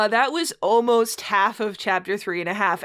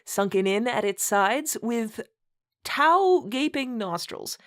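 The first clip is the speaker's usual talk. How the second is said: mock-grand read-aloud, stifling laughter; story narration; 5 semitones higher, slower than usual. Host reading a bad fanfic to co-host, mock-solemn narration. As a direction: read the purple prose with a straight face.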